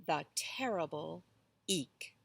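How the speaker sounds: plain reading voice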